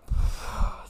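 long heavy exhale; deep sigh. Real, not acted. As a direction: let out a weary sigh. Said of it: "that was a deep sigh".

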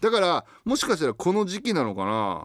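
high, loud, gushing mom character; excited high letter voice. Acted. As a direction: read it as a giddy, pleading mom. Reads letter in mother persona. (興奮した高い声のおかんキャラ)

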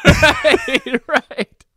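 laughing through the word. Real, not acted. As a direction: laugh while saying it. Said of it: "I'm crying".

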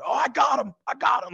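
high-pitched mock struggle; Steve Irwin. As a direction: imitate an excited wildlife man grappling. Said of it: "you hear him, like, doing his thing".

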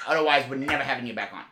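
usual speaking voice

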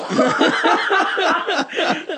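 long, high mimicked laughing fit; high helpless cackle in imitation; imitated nervous laughter. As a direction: act out a friend laughing from fright. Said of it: "Pero a mí me da por reírme".

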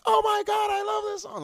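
put-on high, loud squealing girl; excited girl squeal. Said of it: "some girl was like".

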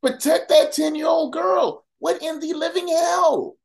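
high, slow, furious shout; outraged shout. Real, not acted. Host shouting at legislators to protect the girl, furious.

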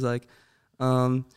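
normal talking voice

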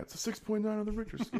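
very high squeaky quoted line; movie line impression. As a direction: squeak it out like the film character. Quoting a movie clip.

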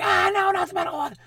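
very high squeal of protest; mock panicked plea. Child pleads against metal spatula.

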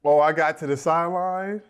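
usual speaking voice

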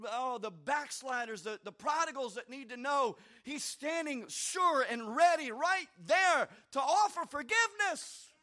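impassioned preacher's plea; preaching appeal. Acted.

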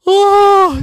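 high, loud aghast "oh"; shocked exclamation. Acted. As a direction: cry out at a sudden mishap. Jackson shocked as toy breaks.

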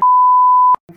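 loud squealing laugh; high shrieking laugh. Real, not acted. Bursts out laughing.

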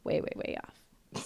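slow whispered repetition. Real, not acted. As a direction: breathe it out slow, no voice. Drawn-out emphasis on the error.

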